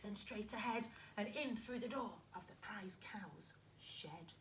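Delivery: quiet hushed plotting voice; robbers whispering. Acted. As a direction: murmur the plan secretly. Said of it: "she heard them whisper".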